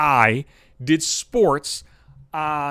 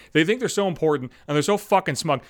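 usual speaking voice

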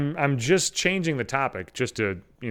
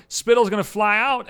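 ordinary delivery

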